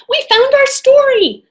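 high, loud cheer; excited. Acted. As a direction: shout it with joy. Kids find their goal.

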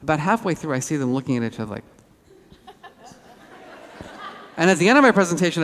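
ordinary talk, nothing marked

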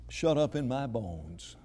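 solemn, measured pulpit confession; hushed preaching. Retold or imitated. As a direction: let each phrase sink low and settle.

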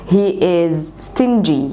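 very slow and clear; repeat after me. Shown as a demonstration. Teacher giving the line for students to repeat, very slow. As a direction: say it slowly so they can echo it. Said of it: "عايزاك تقول ورايا".

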